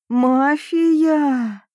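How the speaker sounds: high, drawn-out echo of a word; dazed puzzlement. A narrator's reading. Groggy woman catching only the last word, puzzled. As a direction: echo it slowly, foggy and questioning. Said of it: "До моего изрядно затуманенного сознания дошло только последнее слово".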